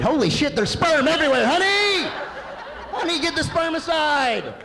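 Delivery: very high, loud shrieking alarm; mock panic. Finding the kitchen infested, shrieking to honey in mock panic. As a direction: scream for help in hammed-up horror.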